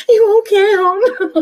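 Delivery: laughing mock comfort. Pretend soothing, laughing.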